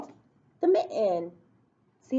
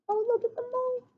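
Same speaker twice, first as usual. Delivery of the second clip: delighted; 12 semitones higher than usual. Acted. Shows picture to kids.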